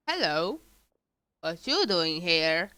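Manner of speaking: ordinary talk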